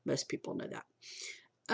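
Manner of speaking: whispered aside with a laugh; breathy chuckling whisper. Real, not acted. Aside after a spoiler joke.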